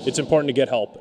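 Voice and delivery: ordinary talk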